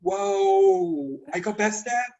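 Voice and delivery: usual speaking voice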